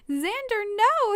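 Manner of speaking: high, loud desperate yell. Acted. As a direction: shout to stop him from punching. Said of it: "Haley yelled".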